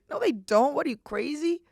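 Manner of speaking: lightly hammed-up disbelief; panicked denial. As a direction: brush it off in a half-joking panic. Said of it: "And I'm like".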